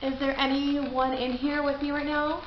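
ordinary delivery

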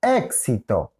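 "éxito" stressed as EK-si-to; stress on first syllable. Shown as a demonstration. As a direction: lean on the opening EK of "éxito". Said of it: "aquí". («éxito» con acento en la primera: ÉK-si-to)

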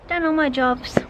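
normal talking voice

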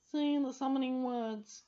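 harsh, loud, slow order from the cat; harsh command. Acted. Cat Carbonel demanding the summoning words, harsh and loud. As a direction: bark the command out slowly. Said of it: "he said harshly".

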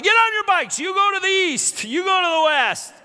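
very high, loud commanding; frantic orders. Retold or imitated. Mother sending daughters east and west, high and loud. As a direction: send them off in a panic.

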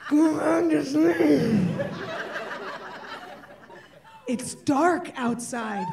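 slow, drawn-out weary plea; slow pleading. Retold or imitated. Tired mother pleading with toddler to sleep. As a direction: stretch each word, begging. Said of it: "And I say".

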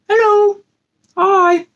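ordinary delivery

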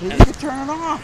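insist, fed up; exasperated insistence. Real, not acted. Wife insisting partner stop recording, loud exasperated demand.

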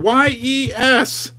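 slow spelled-out letters, each one separate; slow, deliberate spelling of Y E S. Shown as a demonstration. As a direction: spell it out slowly for the viewers. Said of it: "I want you to type the whole word".

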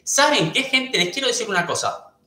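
high, pointed call to her whole chat; excited call-out. Real, not acted. Streamer confronting chat gossips, high and pointed. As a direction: grab the viewers' attention, pitch jumping up.

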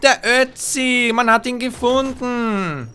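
high, loud, slow grand proclamation; hammed-up announcer. Player announces finding the Ötzi, hammed-up and loud. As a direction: announce it like breaking sensation. Dramatic announcement of found figure.

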